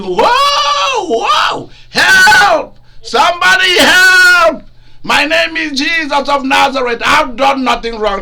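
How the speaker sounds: high, loud desperate shout; shouting for help. Acted. Jesus shouts after the fleeing disciples, loud desperate cry for help. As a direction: scream for help like a man abandoned. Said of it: "they heard him shouting".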